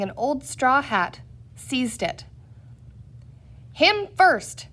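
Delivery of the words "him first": sharp, high, loud command; cried out. A narrator's reading. Chief yells at the mob to hang Peter first. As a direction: bark it out like a mob leader. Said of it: "cried the chief".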